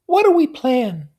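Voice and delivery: high, loud helpless question; high whiny helplessness; helpless Daisy. A narrator's reading. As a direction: ask as if utterly lost. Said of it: "turned to me helplessly".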